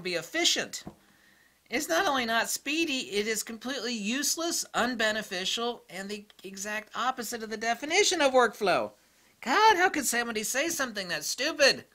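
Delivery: ordinary talk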